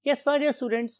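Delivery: usual speaking voice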